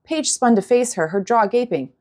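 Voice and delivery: ordinary delivery, nothing marked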